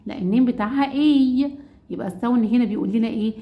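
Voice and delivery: usual speaking voice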